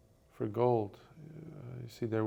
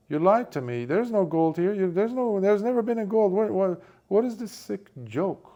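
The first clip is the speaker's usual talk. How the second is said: loud, much higher, indignant ranting; angry outburst; 9 semitones higher, 6 dB louder than usual. Acted. Poor friend ranting at the rich friend about no gold, furious. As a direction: spit out betrayal, voice climbing.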